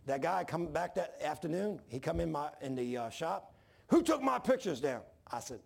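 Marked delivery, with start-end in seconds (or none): 3.9-5.0 loud, high-pitched angry demand; angry confrontation. Retold or imitated. Worker confronts new superintendent over torn pictures, angry imitation. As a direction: burst out as if spoiling for a fight. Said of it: "he acted like he wanted to fight".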